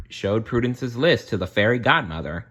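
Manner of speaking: ordinary delivery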